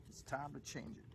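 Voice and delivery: ordinary delivery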